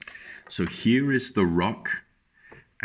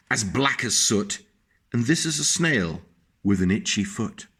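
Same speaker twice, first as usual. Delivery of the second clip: put-on lilting read-aloud voice for a child; sing-song picture-book reading; storybook narration; 4 semitones higher, 4 dB louder than usual. A narrator's reading.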